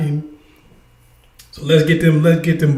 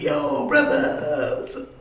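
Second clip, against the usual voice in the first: slow, higher drawn-out bro greeting; 9 semitones higher, 8 dB quieter than usual. Retold or imitated. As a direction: parody the fake street voice. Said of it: "you start like".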